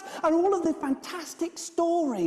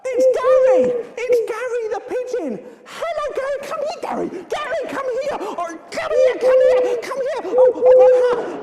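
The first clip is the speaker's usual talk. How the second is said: high, frantic calls to a pigeon; loud bursts of flustered excitement; excited calling out; 9 semitones higher, 8 dB louder than usual. Acted.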